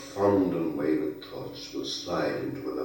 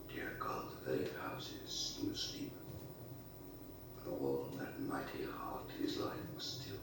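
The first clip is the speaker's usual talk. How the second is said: whispered, breath only, hushed in awe; soft reverent whisper; 4 semitones lower, 14 dB quieter than usual. Acted. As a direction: breathe it out as if the city sleeps. Hushed awe in poem reading.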